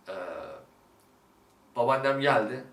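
ordinary talk, nothing marked

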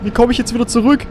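high, frightened voice; anxious and lost; scared. Real, not acted. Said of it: "Ich hab Angst".

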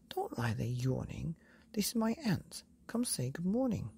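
plain reading voice

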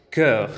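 slow "cœur" with open rounded vowel; French cœur. Shown as a demonstration. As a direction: draw out "cœur". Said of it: "que es".

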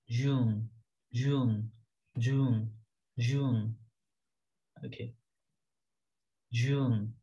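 normal talking voice

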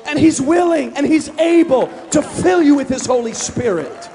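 high, loud, driving exhortation; full-force preaching. Real, not acted. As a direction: build to a shouted peak for the congregation.

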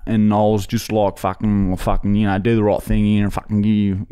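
lightly put-on rough Aussie bloke voice; a hint of a broad Aussie tradie; put-on bogan voice. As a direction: play a crude Aussie bloke on a prank call. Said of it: "this is my real voice. I don't even talk like that".

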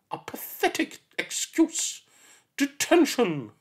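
high, slow, breathy sneer; scornful teacher. Acted. Miss Seeth dismisses the injured child, scornful. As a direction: sneer it contemptuously.

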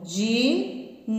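ordinary delivery

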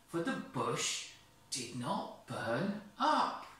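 put-on lively, high read-aloud voice for children; storybook reading. A narrator's reading. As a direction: paint the scene for little ones.

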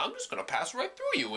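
a cocky little asteroid; talking asteroid. Retold or imitated. Asteroid voiced in his story.

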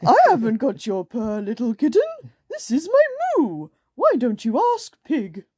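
loud voice climbing very high; put-on cow character voice; storybook cow. A narrator's reading. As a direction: play a cow answering, ending high. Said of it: "think of a voice for all of these characters".

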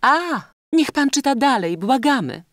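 high, loud eager plea; society lady's insistent entreaty; pleading lady. A narrator's reading. Lady begs reader to go on.

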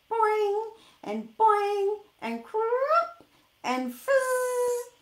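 high, loud, slow silly machine sounds; boing and fizz like a cartoon gadget; hammed-up whirring machine effects; machine noises. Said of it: "the machine goes".

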